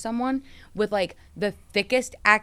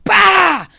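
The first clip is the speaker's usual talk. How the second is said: high, explosive shouted burst; loud mock crash noise; 13 semitones higher, 15 dB louder than usual. Driver joking about how hard they backed out, shouted impact noise. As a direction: blurt out a huge comic blast. Imitates speeding off, against a mere tap.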